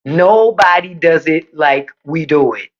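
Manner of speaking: "Nobody does it like we do it" slowed right down; slow emphatic restatement. Real, not acted. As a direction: spell out the boast word by word. Restates boast slowly for emphasis.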